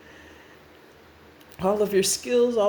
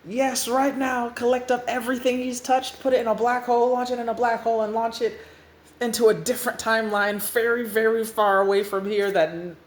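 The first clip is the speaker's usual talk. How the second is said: raised, insistent commands; urgent orders; 5 semitones higher, faster than usual. Acted. New Restart confirms the order to his robots, urgent. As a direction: press the robots to act immediately.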